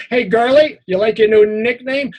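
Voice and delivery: high, loud jeering; a bully mocking the boy's new nickname; mocking taunt. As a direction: sneer it at him loudly.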